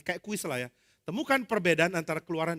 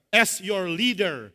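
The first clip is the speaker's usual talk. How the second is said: authoritative; 5 dB louder, much slower than usual. Acted. Musa addresses fighting Israelites.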